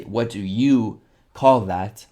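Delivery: What do you call that said slowly with weight on call; teacher stressing the example. Shown as a demonstration. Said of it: "So to say".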